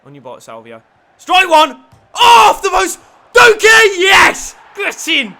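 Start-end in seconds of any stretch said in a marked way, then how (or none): 1.2-5.3 loud, high celebratory yelling; excited goal shout. Real, not acted. Player scores and the gamer yells in joy, excited shout. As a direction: roar it like you just scored.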